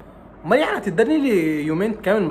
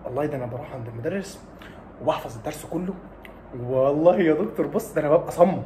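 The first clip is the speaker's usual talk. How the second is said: a quieter, casual student excuse; softer student voice; 4 semitones lower, 4 dB quieter than usual. Retold or imitated. Student brushing off the doctor's advice, softly. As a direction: shrug it off like a cocky pupil.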